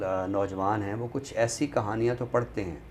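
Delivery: ordinary talk, nothing marked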